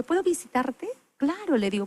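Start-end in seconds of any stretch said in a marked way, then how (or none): 0.0-0.9 imitating the sister. 1.2-1.6 eager yes. Retold or imitated. Her own eager reply, retold.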